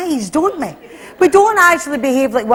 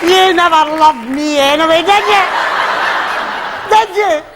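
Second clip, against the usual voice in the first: higher hammed-up wronged wife; mock accusation; 4 semitones higher than usual. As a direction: accuse him, voice climbing.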